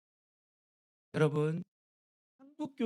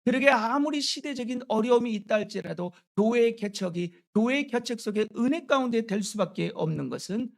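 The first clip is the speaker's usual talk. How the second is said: raised, louder pulpit voice; emphatic preaching; 5 semitones higher, 6 dB louder than usual. Real, not acted. Preacher tells the church the Lord is its owner, emphatic preaching. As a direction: drive the point home to the congregation. Preaches the main point forcefully.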